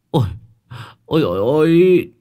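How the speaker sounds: high, loud alarmed "ôi"; startled cry. A narrator's reading. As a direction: gasp it out in fright.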